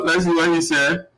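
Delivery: ordinary talk, nothing marked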